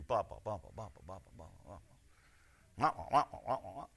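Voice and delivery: fading blah blah mumble; mock nagging babble. Mocking a nagging spouse, fading babble. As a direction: trail off into mock whining noise.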